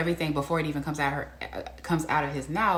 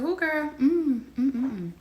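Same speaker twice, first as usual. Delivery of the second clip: put-on high teasing ooh girl, humming along; knowing, scandalized hum; sassy ooh girl; 10 semitones higher, slower than usual. Vlogger reacting to a bold line, high sassy hum.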